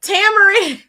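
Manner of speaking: high, loud call of a name. Real, not acted. Calling to Tamarin off camera, loud high call. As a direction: shout the name across the room.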